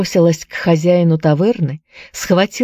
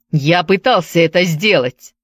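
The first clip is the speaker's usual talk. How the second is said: usual speaking voice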